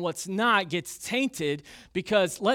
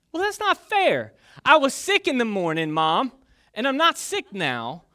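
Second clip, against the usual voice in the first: much higher, loud whining protest; whiny kid protest; 5 semitones higher, 6 dB louder than usual. Retold or imitated. Sick boy whining to his mom that it isn't fair, loud and high. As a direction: whine it like a wronged kid.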